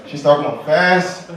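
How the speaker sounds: ordinary talk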